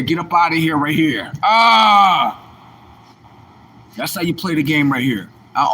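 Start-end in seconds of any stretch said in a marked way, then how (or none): 1.4-2.4 yell. Real, not acted. Shout at end.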